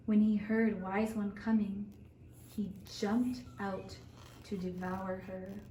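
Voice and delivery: ordinary delivery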